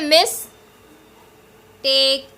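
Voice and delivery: "मिस / टेक" split by a long pause; syllable by syllable. Shown as a demonstration. Teacher counting syllables for students, split in two. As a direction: say "मिस", stop, then "टेक". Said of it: "जैसे मैं बोल रही हूँ ना".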